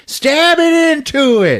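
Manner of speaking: high, loud, drawn-out crazed yell; hammed-up maniac shout; Old Greg. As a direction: stretch it out like a gleeful attacker. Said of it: "so he's like".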